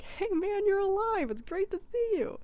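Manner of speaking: overjoyed to find a friend alive; excited greeting. Retold or imitated. Mablung greeting Turin alive again, high excited cheer.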